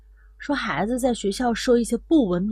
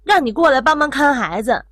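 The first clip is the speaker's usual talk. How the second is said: loud, raised-pitch retold reproach; angry scolding; 5 semitones higher, 6 dB louder than usual. Daughter blames her mother for backfiring help, angrily. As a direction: act out a daughter lashing out. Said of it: "正在气头上".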